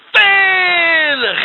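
high drawn-out shout of danger; alarmed shout. Acted. Storyteller's character shouting a warning, long and loud. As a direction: cry out the warning slowly.

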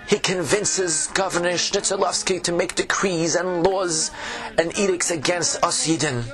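put-on old tavern keeper, Yiddish accent; fretful innkeeper with a Yiddish lilt.

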